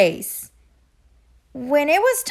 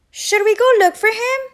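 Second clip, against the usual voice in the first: anxious rush; 10 semitones higher, 6 dB louder, much faster than usual. A narrator's reading. Duck worries about Goose.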